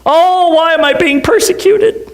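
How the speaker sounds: hungry churchgoer protesting when called on to pray; mock despair. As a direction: whine it like a put-upon kid. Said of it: "I go".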